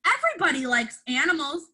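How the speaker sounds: ordinary delivery, nothing marked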